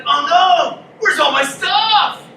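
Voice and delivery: high, loud panicked dismay; mock panic; alarmed Micah. Micah coming home to an empty house, mock panic. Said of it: "he's like".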